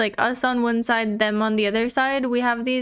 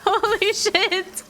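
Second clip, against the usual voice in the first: laughing; 8 semitones higher, slower than usual. Real, not acted. Shocked laugh at near death.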